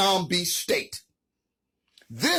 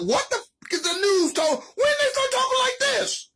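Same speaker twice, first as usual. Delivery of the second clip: much higher, disbelieving outburst; incredulous outburst. Real, not acted. Vlogger reacting to the zombie news report, incredulous and much higher. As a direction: shoot the voice way up in disbelief. Outraged at news talking weird.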